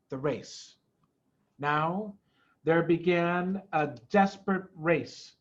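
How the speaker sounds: usual speaking voice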